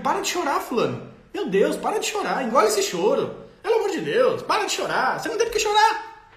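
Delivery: put-on high, loud exasperated telling a child to stop crying; scolding parent. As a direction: nag the kid to swallow the tears. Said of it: "eu digo".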